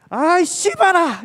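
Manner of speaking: high, loud, drawn-out dialect shout; sudden outburst. Retold or imitated. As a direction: blurt it out across the room. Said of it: "이런 소리가 들리는 거야".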